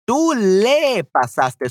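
slowly, stretching "le" to show its place; stress on le. Shown as a demonstration. Showing where le goes.

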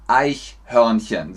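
slow "Eichhörnchen" with palatal ch; correct ich-sound. Shown as a demonstration. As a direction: model "Eichhörnchen" slowly with ch, never sh. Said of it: "Es ist Nummer zwei". ("Eichhörnchen" langsam mit richtigem ch)

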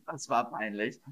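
ordinary talk

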